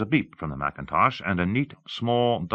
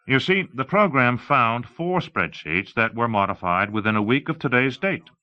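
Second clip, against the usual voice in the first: higher-pitched explainer; lightly voiced demonstrator; 6 semitones higher, 4 dB louder than usual. Explaining the found spreadsheets to onlookers, lightly voiced presenter. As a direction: point out the result with emphasis.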